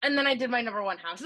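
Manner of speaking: ordinary talk, nothing marked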